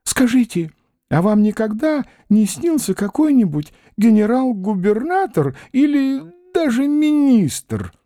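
high, wistful, slowing questions; envious old man. A narrator's reading. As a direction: marvel at his lucky dreams. Old man's envious wonder.

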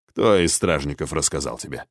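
low, displeased question; frowning. A narrator's reading. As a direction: demand an answer with a quiet frown. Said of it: "нахмурился Архонт". (нахмурившись, негромко)

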